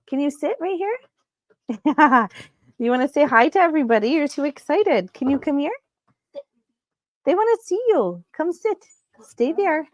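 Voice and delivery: high, sing-song voice coaxing a dog; high pet-talk. Real, not acted. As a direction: coo at an excited puppy to make her sit.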